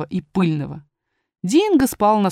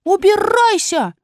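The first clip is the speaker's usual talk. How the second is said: high, drawn-out storybook shout; indignant cry to go away; shouted dismissal; 11 semitones higher, 3 dB louder, slower than usual. A narrator's reading. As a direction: cry it out slowly, high and rolled. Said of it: "он выскочил из неё и закричал".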